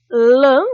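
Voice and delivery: "lã" loud and lengthened; stressed syllable. Shown as a demonstration. Teacher repeating the tonic syllable to pupils, "lã" loud and drawn out. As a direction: call out "lã", holding it long. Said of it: "é a sílaba tônica".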